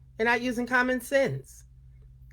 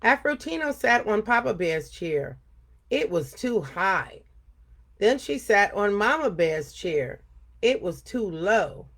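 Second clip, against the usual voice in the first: put-on lively read-aloud for kids; storytelling voice for a young audience; story reading. A narrator's reading. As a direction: tell it like story time for children. Reads story to children.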